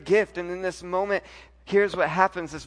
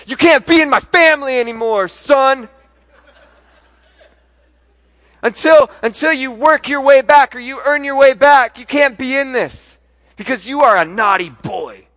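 much louder and higher, hammed-up rage; mock furious scolding; 8 semitones higher, 15 dB louder than usual. Pastor mocking a father who disowns his son, hammed-up fury. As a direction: play the overreacting dad for laughs.

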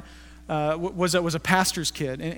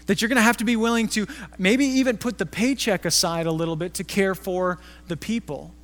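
normal talking voice

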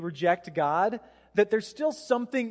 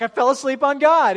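loud, higher, dismayed outburst; mock horrified realization; 6 semitones higher, 11 dB louder than usual. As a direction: exclaim it like you just woke up guilty. Acted horrified morning realization.

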